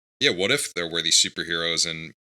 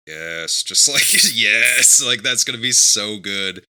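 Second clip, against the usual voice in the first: excited yes; 2 semitones higher, 5 dB louder, slower than usual. Retold or imitated. Retelling excitement at the news.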